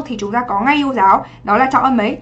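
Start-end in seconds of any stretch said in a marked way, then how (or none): none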